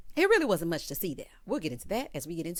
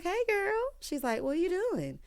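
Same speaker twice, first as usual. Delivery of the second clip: imitated friendly greeting; 10 semitones higher than usual. Acted phone greeting between friends.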